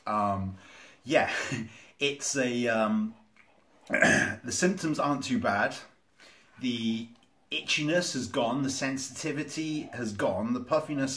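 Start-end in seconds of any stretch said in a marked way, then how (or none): none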